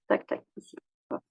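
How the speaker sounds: ordinary talk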